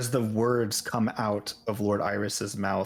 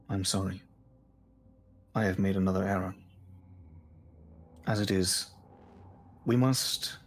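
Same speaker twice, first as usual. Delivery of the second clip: lower, soft apologetic calm; contrite lord; 4 semitones lower, 4 dB quieter than usual. Acted. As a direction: settle them down, lower and gentle. Lord Iris apologises and calms them.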